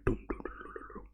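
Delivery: hushed dum dum fading to nothing; whispered mock drumbeat; whispered music imitation. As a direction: breathe the soft jungle drum beat. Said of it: "It's like the".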